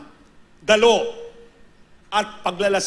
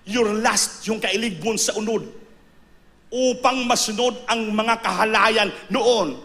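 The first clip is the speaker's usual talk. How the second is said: usual speaking voice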